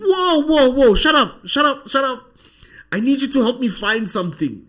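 high, loud, urgent re-enacted outburst; frantic shushing. Man stopping his talking friend to find a scripture, frantic. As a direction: cut him off in a high, panicked yell.

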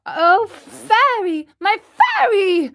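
high, desperate plea; panicked puppet boy, pitched well up; crying out. A narrator's reading. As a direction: call to the fairy in panic. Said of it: "the marionette cried out".

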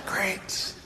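whispered dry sarcasm; sarcastic whisper. Retold or imitated. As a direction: breathe it out, unimpressed. Sarcastic reaction, no voice.

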